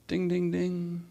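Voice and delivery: soft, slow, high "ding, ding, ding"; imitated bell. Preacher flagging another hint to listeners, imitated bell. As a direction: chime it softly like a little bell.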